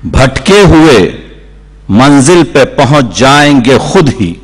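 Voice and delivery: declaimed mushaira couplet; Urdu poetry recitation. Acted. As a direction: deliver the verse in measured, ringing phrases with pauses.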